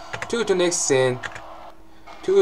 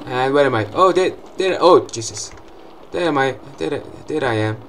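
ordinary talk, nothing marked

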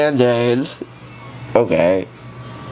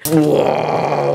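normal talking voice

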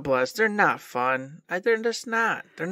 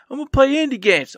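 mock chirpy promise; 9 semitones higher, 3 dB louder, faster than usual. Mocking past self's promise.

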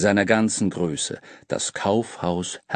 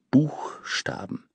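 geflüstert; 4 semitones higher, 4 dB quieter, much slower than usual. A narrator's reading. Staunende Entdeckung geflüstert.

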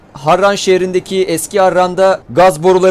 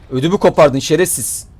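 ordinary talk, nothing marked

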